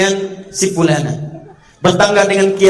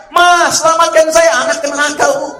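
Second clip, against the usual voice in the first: put-on child crying out; 10 semitones higher, 4 dB louder than usual. Preacher voicing a child begging its mother from hell, pleading cry.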